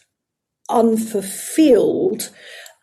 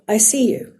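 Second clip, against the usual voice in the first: soft, whispered I see you; tender reassurance to oneself; gentle reassurance; 4 dB quieter than usual. Retold or imitated. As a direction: calmly acknowledge the fear. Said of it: "then I go".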